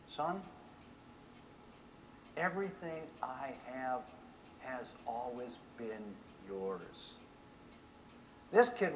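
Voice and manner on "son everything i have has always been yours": tender father. Acted. Father answers angry son tenderly.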